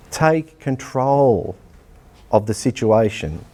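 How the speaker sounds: coach's deliberate, stretched command; slow emphatic. Shown as a demonstration. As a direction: Take control drawn out very slowly, leaning on Take. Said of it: "That's my leadership lesson".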